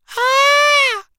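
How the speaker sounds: sudden high shriek of fright; short piercing yelp, jolted awake; startled scream. Acted. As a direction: jump and scream at the surprise. Startled by the monkey.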